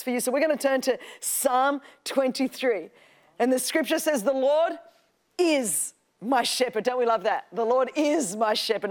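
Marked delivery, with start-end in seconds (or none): 4.2-6.8 slow, with a pause before a weighty "is"; "is" set apart and drawn out; stress on IS. Shown as a demonstration. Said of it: "Not he was, but he is". 7.5-8.9 emphatic, lengthened "is"; stress on IS. Shown as a demonstration. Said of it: "Not he was, but he is".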